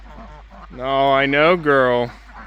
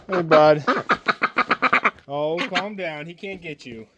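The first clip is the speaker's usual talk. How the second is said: softly reassuring, dropping very quiet; soothing hush; 3 dB quieter than usual. Real, not acted. Calming the frightened hen as the dog sniffs, hushed. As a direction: settle a scared bird with a hushed voice.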